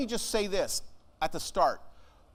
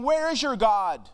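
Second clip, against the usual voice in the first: loud taunting challenge; 6 semitones higher, 6 dB louder, slower than usual. Acted. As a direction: build to a high, loud demand. Reading the taunt of the enemies.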